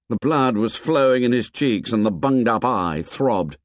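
usual speaking voice

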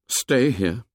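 lightly voiced, scornful echo; Ralph voice. A narrator's reading. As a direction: throw the words back at him. Ralph echoes Piggy scornfully.

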